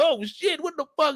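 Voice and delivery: high yelp of shock; startled. Pretend. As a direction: react to a static zap. Zapped under the dryer.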